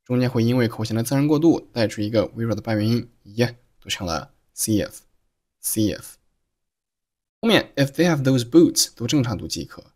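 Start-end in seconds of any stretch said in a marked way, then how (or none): 4.5-6.1 see if joined by a faint y glide; vowel linking with y. Shown as a demonstration. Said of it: "See和if又发生了元音连读".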